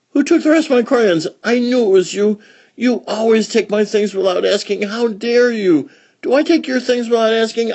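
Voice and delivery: put-on high, loud, furious child's rant; angry outburst. Child yells at the one who took her crayons, furious. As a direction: tear into the crayon thief. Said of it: "An outburst in many words".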